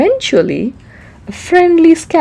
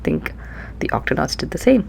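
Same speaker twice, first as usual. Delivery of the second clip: usual speaking voice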